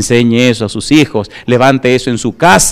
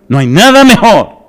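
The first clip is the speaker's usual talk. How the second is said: preaching shout; 7 semitones higher, 5 dB louder than usual. Real, not acted. Preacher proclaiming to congregation.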